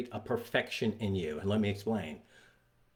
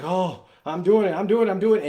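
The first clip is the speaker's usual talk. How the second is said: loud, high-pitched acted triumph; excited straining lifter; 8 semitones higher, 9 dB louder than usual. Lifter mid-rep as the bar goes up, loud excited disbelief. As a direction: blurt it out loud, amazed at your own lift.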